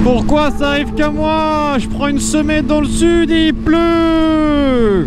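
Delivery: mock whining. Real, not acted. Complaining about rain on holiday.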